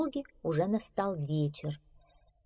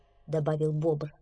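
ordinary delivery, nothing marked

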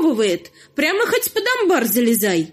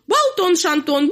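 mocking turkey announcement; 5 semitones higher than usual. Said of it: "на весь свет оповещает".

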